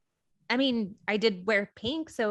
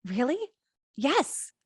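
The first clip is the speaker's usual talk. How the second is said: excited; 4 semitones higher than usual. Acted. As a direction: jump at the offer with excitement. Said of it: "Cassie's face changes to excitement".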